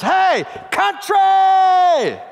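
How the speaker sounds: loud high mocking shout from a passing cab; yelled taxi driver's call. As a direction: holler it out the window at a country boy. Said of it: "and goes".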